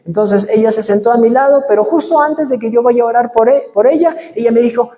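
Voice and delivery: ordinary talk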